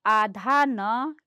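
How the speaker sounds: आधा न stretched out very slowly; drawn-out answer. Shown as a demonstration. Teacher explains the anusvara to children, slowly. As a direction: lay out आधा न slowly and clearly. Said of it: "वो कहीं पर".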